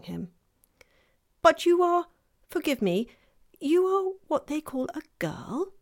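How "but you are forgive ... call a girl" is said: shy fawn voice. Acted. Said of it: "said the fawn".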